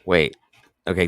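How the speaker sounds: usual speaking voice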